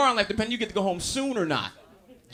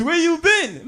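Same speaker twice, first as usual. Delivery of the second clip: cousin asking; 10 semitones higher, 6 dB louder, slower than usual. Retold or imitated. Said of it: "He ask you dumb questions".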